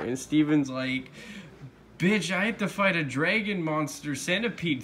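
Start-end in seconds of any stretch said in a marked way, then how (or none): 2.0-4.8 mock-indignant, pitched up; higher-pitched retold outrage; upset retort. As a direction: act out an upset kid's comeback. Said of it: "Steven gets really upset at this".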